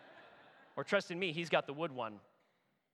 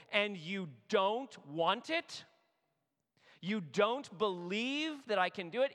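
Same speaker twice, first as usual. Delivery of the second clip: higher and louder, exasperated disbelief; frustrated God; 5 semitones higher, 5 dB louder than usual. Acted. God rebuking his rescued people, frustrated and louder. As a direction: plead like a betrayed parent listing every gift. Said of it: "how we speak when we're so frustrated".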